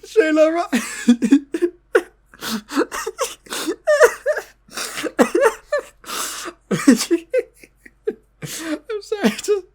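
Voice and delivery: high, breathless laughter through the words; can barely get the words out for laughing; real laughing fit. Real, not acted. Retelling a funny video to viewers, helpless laughter. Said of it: "it genuinely makes me laugh".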